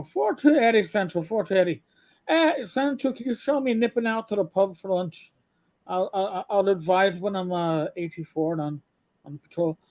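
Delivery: put-on Irish brogue, calling in to Central. Officer joking over police radio to Central, in Irish brogue. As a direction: play an Irish cop on the radio. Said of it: "He had the Irish brogue, and you'd hear him talking like that".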